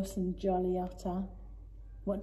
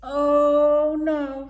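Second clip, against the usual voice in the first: louder, higher oh no; dismayed cry; 4 semitones higher, 9 dB louder than usual. Acted. Dylan realising Owl bought Titchy Chick, dismayed. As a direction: gasp out the alarm. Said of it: "said Dylan".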